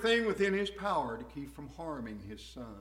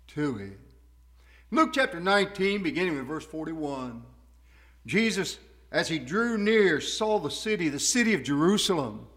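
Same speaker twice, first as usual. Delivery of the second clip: usual speaking voice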